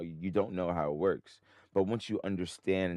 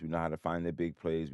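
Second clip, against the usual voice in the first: usual speaking voice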